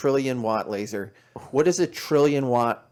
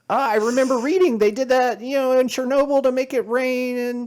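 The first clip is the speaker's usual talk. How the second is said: high, louder mock voice imitating the host; 13 semitones higher, 5 dB louder than usual. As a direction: sneer it in a high parody voice. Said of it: "She said".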